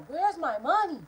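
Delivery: high-pitched mock yell, not full volume; acted-out angry demand; retold yelling. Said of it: "so I started yelling".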